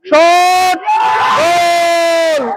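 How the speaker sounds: high shout, goal drawn out long; frantic football commentary. Real, not acted. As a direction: call the shot, then stretch the goal. Commentator calls shot and goal.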